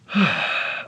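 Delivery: loud, falling sigh; heavy sigh. Real, not acted. Tutor after finishing the quadratic formula, heavy sigh. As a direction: let out a big breath.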